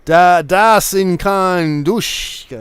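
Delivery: slow, mock German gibberish. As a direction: fake a German accent, slow and exaggerated. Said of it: "This is no German".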